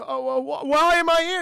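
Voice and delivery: high, stammering mock alarm; flustered Santa. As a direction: build to a loud bewildered question. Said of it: "Santa's just like".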